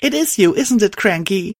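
high, urgent questioning; high-voiced disbelieving heroine; astonished heroine. A narrator's reading. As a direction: press the ghoul for answers, pitch way up.

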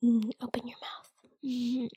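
soft breathy whisper, voiceless. Acted. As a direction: whisper it close to the ear. Intimate whisper.

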